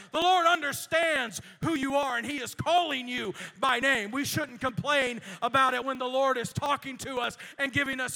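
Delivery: loud, rising preacher's exhortation; full-force preaching. Real, not acted. Pastor encourages the congregation, preaching at full force. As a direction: build up to a shout for the congregation.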